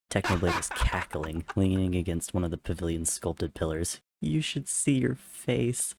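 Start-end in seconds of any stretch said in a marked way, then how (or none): 4.2-5.9 slow, high, laughing through it; wheezing with laughter. A narrator's reading. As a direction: wheeze it out mid-guffaw. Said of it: "wheeze out between his guffaws".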